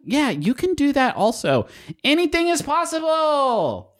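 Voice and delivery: rising to a big high "Anything is possible"; building excitement. Acted. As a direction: pitch it up bigger and bigger. Ad read building to a shout.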